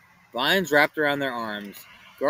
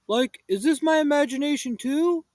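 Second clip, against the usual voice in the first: high loud mocking question; Shaggy mocking Velma's explanation; sarcastic Shaggy; 12 semitones higher, 5 dB louder than usual. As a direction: challenge her with sarcasm.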